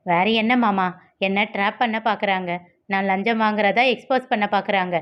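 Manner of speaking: usual speaking voice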